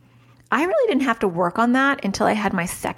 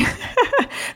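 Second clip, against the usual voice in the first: quick amused laugh; a brief laugh; short laugh. Real, not acted. Laughs at own joke.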